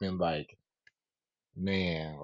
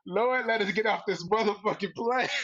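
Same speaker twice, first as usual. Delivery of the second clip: laughing prayer; 10 semitones higher than usual. Retold or imitated. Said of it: "I'm like".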